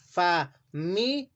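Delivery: "Familia" split slowly as fa-mi-lia; "Familia" broken slowly into its syllables, fa-mi-lia; slow syllabified. Shown as a demonstration. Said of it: "¿Cómo dividimos la palabra familia?".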